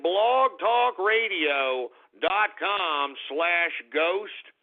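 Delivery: drawn out, every syllable clear; slow repeated address. Real, not acted. Host repeating his website, slow and deliberate. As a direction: spell it out slowly for listeners.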